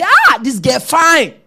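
mock smitten sigh of admiration; admiring Samson. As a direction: savour it like Samson eyeing Delilah. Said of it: "The very eye he used to say".

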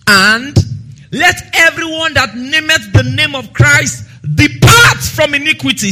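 scripture reading. Acted. Preacher reads Timothy aloud to church, proclaiming. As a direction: proclaim the verse to the congregation.

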